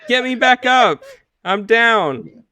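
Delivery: hammy downed-player call. Acted. Downed game character calling teammates, hammy cry for help. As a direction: call out for a revive, playing it up. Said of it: "tired of mediocre game deaths".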